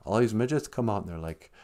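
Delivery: normal talking voice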